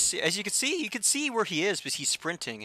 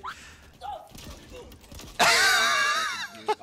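laughing shout. Real, not acted. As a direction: howl with laughter. Big play in game.

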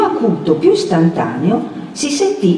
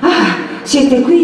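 panting surprise, lifted in pitch; gasp, then squeeze the question out; out of breath from running; words forced out breathlessly; 7 semitones higher, 3 dB louder, much slower than usual. A narrator's reading. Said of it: "cavando fuori la parola a stento".

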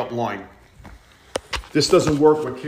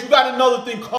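shouting; 8 semitones higher, 6 dB louder, faster than usual. Acted. Dad's angry rant.

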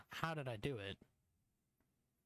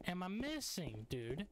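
higher, pleading frustration; exasperated; 5 semitones higher than usual. Real, not acted. Lost gamer asking what he's missing, exasperated. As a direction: throw the question up in disbelief.

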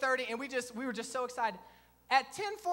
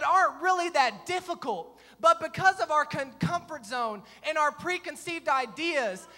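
raised, driving sermon delivery; emphatic preaching; 3 semitones higher, 5 dB louder than usual. Real, not acted. Preacher turning his story into a lesson, forceful preaching. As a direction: drive the lesson home to the congregation.

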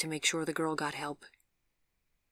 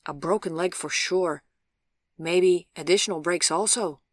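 louder, lightly voiced ambulance man; assessing orderly; 8 dB louder than usual. A narrator's reading. Orderly reports injuries to a policeman, louder and matter-of-fact. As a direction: give a brisk medical verdict aloud.

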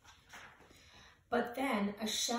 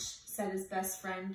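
plain reading voice

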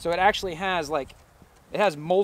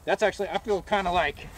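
muffled behind mask; through a gas mask; 3 semitones higher than usual. Real, not acted. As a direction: voice inside respirator. Seen 1: Hoodie man wears full gas mask throughout; unmasked in references.

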